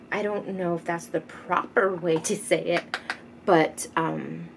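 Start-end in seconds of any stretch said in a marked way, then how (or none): none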